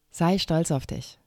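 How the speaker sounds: ordinary talk, nothing marked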